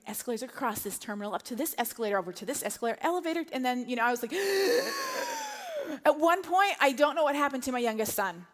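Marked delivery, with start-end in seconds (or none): 4.4-6.0 high, long, straining gasp; drawn-out acted gasp; panicked gasp. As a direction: suck in air in exaggerated panic. Breathless dash through the airport.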